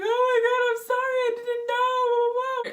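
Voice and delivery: very high, drawn-out whine; mock wailing apology. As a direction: beg for mercy in a hammed-up cry. Child begging during retold beating.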